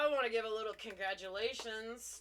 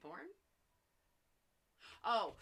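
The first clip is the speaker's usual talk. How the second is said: ordinary delivery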